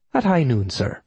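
reply in an Irish brogue; Irish accent. A narrator's reading. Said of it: "I replied in kind".

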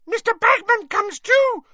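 very high, loud elf squeak; Winky squeaks at Harry, very high and loud; squeaking house-elf. Acted. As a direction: squeak it out in alarm. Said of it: "squeaked Winky".

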